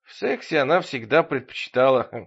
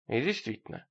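ordinary delivery

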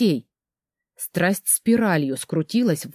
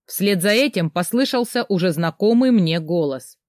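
ordinary delivery, nothing marked

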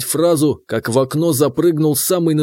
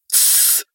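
unvoiced sharp shushing; 7 dB quieter than usual. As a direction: hiss him quiet fast. Hushing him abruptly.

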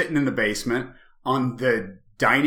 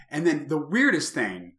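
ordinary talk, nothing marked

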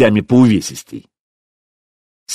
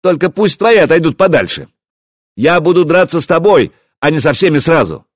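high, raised, firm voice; calling out; 7 semitones higher, 4 dB louder than usual. A narrator's reading. Hero setting duel terms for the prince, calling out. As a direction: call conditions across the yard.

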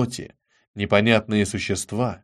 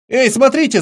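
put-on high, loud boyish cry of alarm; sudden shout; 11 semitones higher, 7 dB louder than usual. A narrator's reading. Will calls his friends to the jar, shouting. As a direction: call out abruptly at the top of the range. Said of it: "закричал вдруг Уилл".

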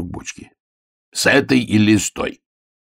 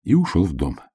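ordinary delivery

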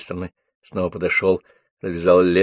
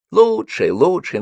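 mocking; 8 semitones higher, slower than usual. Женька передразнивает «лучшая группа».

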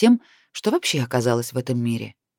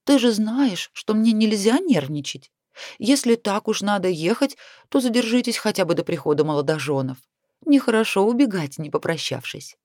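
higher-pitched wheedling complaint; gently nagging, pitched up; whining plea; 6 semitones higher than usual. A narrator's reading. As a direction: plead with a mild whine, voice lifted. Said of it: "законючила она".

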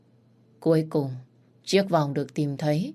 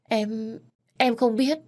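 stammering; 7 semitones higher, slower than usual. Acted. Maid stammers in fear.

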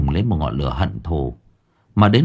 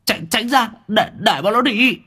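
high-pitched frightened call; acted shout, very high and stuttering; 13 semitones higher than usual. A narrator's reading. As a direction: stammer out a panicked order to the gang. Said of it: "nói to".

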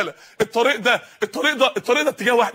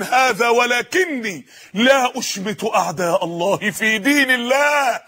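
solemn slow quote; much slower than usual. Acted. Quoting the martyr's solemn refusal.

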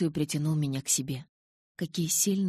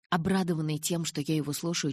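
ordinary delivery

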